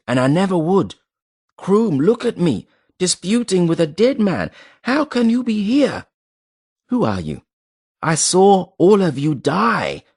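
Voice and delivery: high, raving, frantic cries; mad with grief. A narrator's reading. Hedrigal raving at men he saw die, frantic. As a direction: rave like a man seeing ghosts. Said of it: "quite mad with grief or shock".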